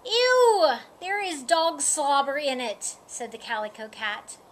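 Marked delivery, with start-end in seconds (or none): 0.0-3.0 high, loud "ew", easing off after; grossed-out cat, starting high; disgusted. A narrator's reading. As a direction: recoil in storybook disgust. Storybook cat disgusted by dog slobber.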